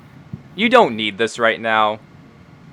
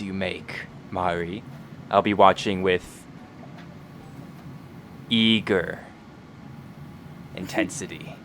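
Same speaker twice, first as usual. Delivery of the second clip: slow, sinister scheming voice; sly honeyed whisper; 4 dB quieter than usual. Acted. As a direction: savor each word slyly. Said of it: "his sinister, scheming voice".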